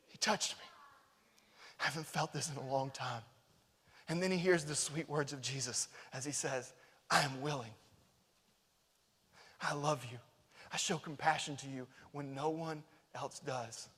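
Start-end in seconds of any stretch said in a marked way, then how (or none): 0.1-3.2 whispered, softer wonder; the leper whispering in amazement; awed whisper. Retold or imitated. Leper marvels at the touch. 7.1-13.9 soft, gentle voice of love; hushed words of compassion; tender Jesus. Retold or imitated.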